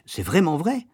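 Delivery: high rising, disbelieving question; child exclaiming. A narrator's reading. Child asking Nounou to confirm her tale, exclaiming. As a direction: press for the truth, pitch climbing. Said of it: "m'écriai-je".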